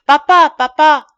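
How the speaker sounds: put-on high, loud child's cry; pleading child. A narrator's reading. Daughter tugging at her father's coat, loudly pleading. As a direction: call out urgently like a child.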